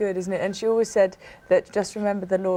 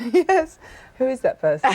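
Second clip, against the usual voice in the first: laughing; 6 semitones higher, 4 dB louder than usual. Real, not acted. Laughing at being praised.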